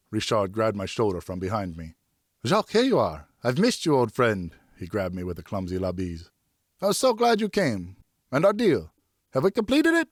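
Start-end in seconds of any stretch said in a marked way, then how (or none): 2.5-4.6 higher, louder, merry tipsy friend; tipsy friend Richard. Acted. Narrator voices tipsy Richard. 6.8-10.1 higher, louder, merry tipsy friend; tipsy friend Richard. Acted. Narrator voices tipsy Richard.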